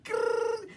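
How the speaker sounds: high, snarling growl; mock dolphin growl. Bully dolphin growling at Maggie, high snarl. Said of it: "one scoffed".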